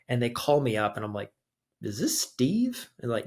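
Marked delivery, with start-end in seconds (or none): 1.8-2.9 high, slow questioning caller voice; a rising, drawn-out phone query; imitating the caller. Family friend on the phone checking who answered, high and slow.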